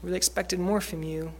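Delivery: imitated mild disappointment; disappointed. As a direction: quietly act out a disappointed parent.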